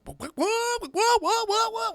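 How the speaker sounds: high, revving whoa-whoa engine noise; truck engine imitation. Friend's truck rolling up, high revving engine mimic. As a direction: mimic a truck roaring up the street.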